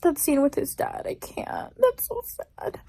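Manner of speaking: tearful hushed whisper; whispering through real tears; crying. Real, not acted. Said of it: "I'm literally crying".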